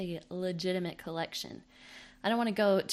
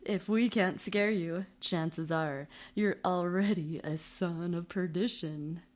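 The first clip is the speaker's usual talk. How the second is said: spoof ad read; 7 dB louder than usual. Acted.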